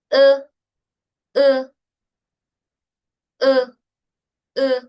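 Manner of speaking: E held level, made far back; back vowel. Shown as a demonstration. Teacher models the Chinese sound to students, E from the back. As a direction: say E from the back of the throat. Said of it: "También es un sonido de, de acá".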